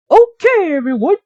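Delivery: high, loud squeaky mouse alarm; a little mouse crying out in alarm; high, urgent cartoon mouse; Gus the mouse. Gus voiced.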